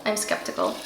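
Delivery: whispered I'm skeptical aside. Shown as a demonstration. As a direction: whisper it like a secret doubt.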